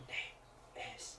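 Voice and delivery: slow, breathy ghostly whisper; haunting whisper. Retold or imitated. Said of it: "it's like".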